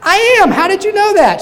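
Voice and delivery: very high, loud mock astonishment; astonished. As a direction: squeal out the surprise. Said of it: "The guy's like".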